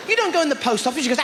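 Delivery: normal talking voice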